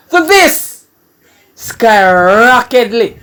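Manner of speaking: high, loud and drawn-out hype; amazed exclamation. Real, not acted. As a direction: shout it upward, thrilled by the singer. Amazed at singer's leap in performance.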